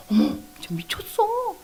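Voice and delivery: high, hushed gasp of disbelief; shocked reaction. Retold or imitated. Woman shocked by a couple in the street, scandalized gasp.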